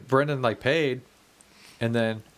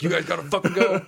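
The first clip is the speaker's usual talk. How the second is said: bouncer voice; 7 semitones higher, 4 dB louder than usual. Retold or imitated. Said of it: "he's like".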